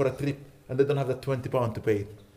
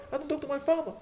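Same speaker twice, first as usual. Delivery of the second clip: high, mocking repeat of the words; incredulous echo; 12 semitones higher, 4 dB quieter, faster than usual. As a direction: echo them back in disbelief. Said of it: "Can you believe that, Sheikh Omar?".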